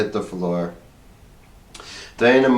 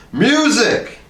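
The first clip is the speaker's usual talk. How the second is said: higher sharp order; commanding; 12 semitones higher, 3 dB louder than usual. A narrator's reading. Harrison orders the musicians to play, higher. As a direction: snap the command. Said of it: "he commanded".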